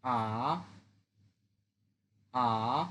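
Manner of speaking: আ dipping low then breaking up; Mandarin third tone. Shown as a demonstration. Teacher shows students the third tone, low dipping. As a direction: dip আ down low and bend it back. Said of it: "third tone ভেঙে বলতে হবে".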